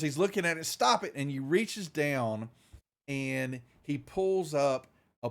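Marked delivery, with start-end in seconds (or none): 0.7-1.1 sharp shout. Acted. Doctor yells at the door.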